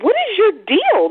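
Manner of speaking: high, exasperated what-is-your-deal. Retold or imitated. To her controlling boyfriend, high exasperated challenge. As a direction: toss it up high, fed up.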